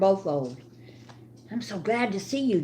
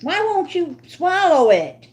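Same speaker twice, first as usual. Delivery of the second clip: pleading with the dog; 10 semitones higher, 7 dB louder than usual. Real, not acted. Dog won't swallow the hot dog.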